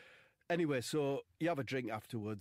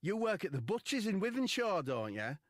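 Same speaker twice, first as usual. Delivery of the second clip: lass's voice; 10 semitones higher, 6 dB louder than usual. Retold or imitated. Lass voiced in story.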